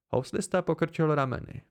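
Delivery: usual speaking voice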